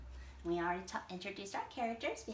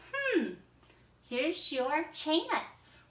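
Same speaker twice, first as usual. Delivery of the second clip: higher, louder, very slow and knowing; sly Arctic hare; 5 semitones higher, 6 dB louder, much slower than usual. Acted. Hare tells the alligator to look up, slow and sly. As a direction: tease him gently with the chance. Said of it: "he'd go".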